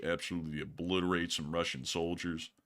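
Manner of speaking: low, soft trailer narrator; movie trailer voice. Acted. Trailer-style ad read.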